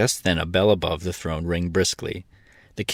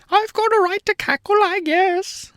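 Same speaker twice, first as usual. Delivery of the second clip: hen voice; 4 dB louder than usual. A narrator's reading. Billina voiced in the story.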